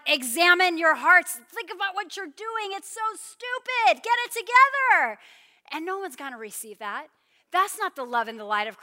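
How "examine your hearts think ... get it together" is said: higher and louder, scolding from on high; mock harsh rebuke. Preacher mocking a harsh floodlight sermon at sinners. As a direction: lecture them like a stern judge. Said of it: "be like".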